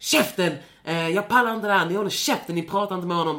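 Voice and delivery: loud, high yell; furious yell, replayed at full force; retold shout. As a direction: bark it at the bullies. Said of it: "skriker och skäller ut dem".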